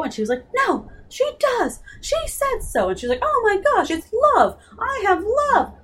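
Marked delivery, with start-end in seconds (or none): 0.7-2.9 put-on high girlish insistence. Meg insisting Miss Whatsit loves her. 3.2-5.7 excited realization. Retold or imitated. Meg's breakthrough realization.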